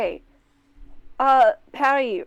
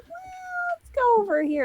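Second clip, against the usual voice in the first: imitating the players. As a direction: mimic them in a small high voice. Game master imitating the players.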